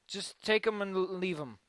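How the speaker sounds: ordinary talk, nothing marked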